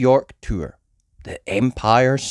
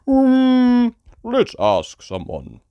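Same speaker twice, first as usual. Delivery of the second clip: Daddy Pig; 12 semitones higher, 5 dB louder, much slower than usual. Acted.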